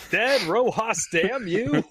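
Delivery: hammed-up "damn you"; mock cursing. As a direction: curse them in playful outrage. Mock curse at a friend.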